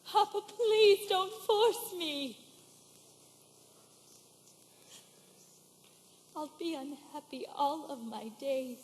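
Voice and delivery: slow plea fading low and soft; subdued plea. Acted. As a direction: beg father, sinking into despair. Daughter quietly begs father.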